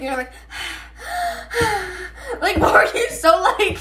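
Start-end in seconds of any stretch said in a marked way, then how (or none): 0.3-3.8 laughing breathlessly, pitch jumping high; out of breath. Real, not acted. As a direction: gasp through laughter, winded. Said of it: "really out of breath".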